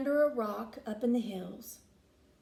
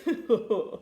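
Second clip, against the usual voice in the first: bullfrog laugh; 3 dB louder than usual. A narrator's reading. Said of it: "said the bullfrog with a laugh".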